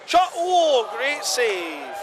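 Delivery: sudden high shout at the save; excited shout. Real, not acted. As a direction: yell it out as the keeper stops it. Commentator reacts to save.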